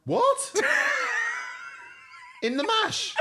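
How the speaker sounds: high, loud shocked "what"; horrified laughing outrage at olive oil; shocked disbelief. Real, not acted.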